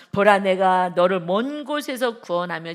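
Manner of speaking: slow, loud proclamation; solemn booming reading; scripture reading. Acted. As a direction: declare the verse slowly. Reads scripture verse.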